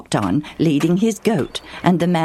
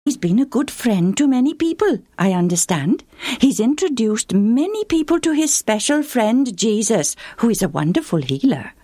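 stranger voice; 7 semitones higher than usual. Acted. Stranger asks about Legion.

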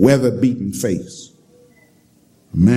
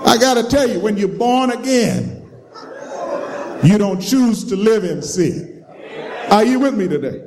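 preaching at full force; 8 semitones higher than usual. Acted. Preacher exhorts the congregation.

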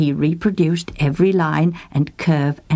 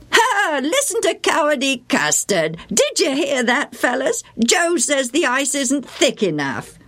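high, teasing schoolboy jeer; mock the timid friend to the others; sneering boy voice; 9 semitones higher than usual. A narrator's reading. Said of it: "Bill mocked".

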